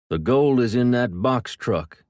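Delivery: loud, slow shout over the radio. A narrator's reading. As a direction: call it out urgently. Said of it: "came through the radio".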